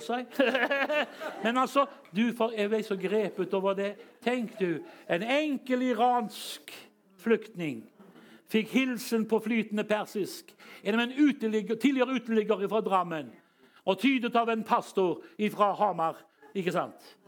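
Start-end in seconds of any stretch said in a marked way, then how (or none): none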